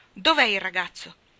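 loud, demanding question; sharp outburst. A narrator's reading. Marilla confronting Matthew at the door, loud and sharp. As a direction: bark it out in alarm. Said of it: "sbottò".

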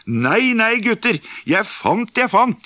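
put-on high, eager call to the boys; excited call. Askeladden hailing his brothers about his find, high eager call. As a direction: sing out the find, delighted.

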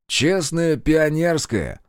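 slow, drawn-out mocking vow; mock oath. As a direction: swear it with exaggerated mock sincerity.

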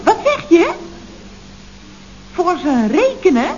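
ordinary talk, nothing marked

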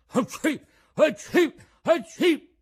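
explosive high sneezes, building; three loud sneezes. A narrator's reading. Mangiafoco, moved to pity, sneezes three times loudly. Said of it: "E fece altri tre starnuti".

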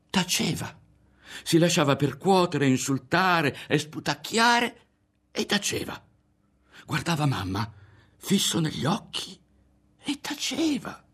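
very high, strained narration; agitated telling. A narrator's reading. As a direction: relive it in a high, horrified pitch. Teller relives her silent endurance.

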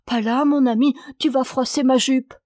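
wife hastily warning her husband off her skirt; hasty alarm. Acted. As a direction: stop him before he sits. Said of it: "avec précipitation".

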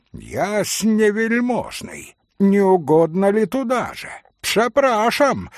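high, mock-courteous call; exclaimed invitation. A narrator's reading. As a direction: call out with exaggerated politeness, pitch high. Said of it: "воскликнул к маленькому пану". (насмешливо-вежливый громкий возглас)

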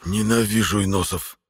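rasping from the floor; hoarse rasp. Acted. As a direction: croak it out through smoke. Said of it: "прохрипел с пола Витер".

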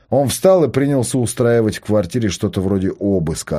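plain reading voice

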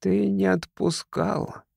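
a lightly creaked dead woman's line; creaky rasp. A narrator's reading. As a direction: rasp it slowly like a corpse. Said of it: "проскрипела".

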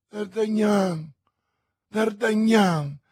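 high, drawn-out calling of a name; pleading, stretched cry; anguished exclamation. A narrator's reading. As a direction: call out slowly for your beloved. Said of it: "esclamò Madame Bonacieux".

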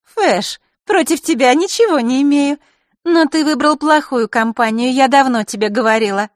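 put-on high, patronizing girl's voice; condescending girl. A narrator's reading. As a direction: scold him like an old friend. Маришка lectures Fesh.

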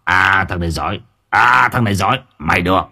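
simmering, put-on spiteful boss voice; resentful. A narrator's reading. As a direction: stretch the opening "À" with menace. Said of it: "Gã chủ thầu liền hằn học". (hằn học, đe nẹt)

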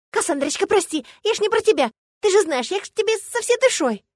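high-pitched pleading yelp; frantic, high excuse-making; wailed apology. A narrator's reading. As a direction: wail for forgiveness. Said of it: "Тут же завопил Гыр".

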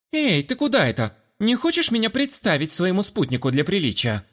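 put-on high, teasing girlish voice; playful young woman. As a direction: tease her brother playfully. Sister blocks the way.

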